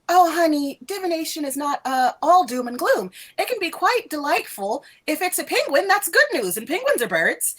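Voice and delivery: high, breezy airhead teacher voice; airhead professor. Acted. Professor soothing a worried student, high airhead voice. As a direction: chirp it like a ditzy, upbeat professor. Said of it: "not her, like, high, airhead kind of voice".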